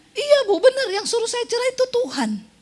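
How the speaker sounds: high, earnest imitation of a client; mock the stubborn client, high; mimicking counselee. Preacher mimics a client insisting God said divorce. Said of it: "counselee insists God said so".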